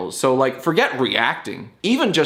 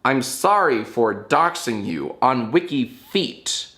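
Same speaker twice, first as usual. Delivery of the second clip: slow example line; slower than usual. Shown as a demonstration. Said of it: "One final example".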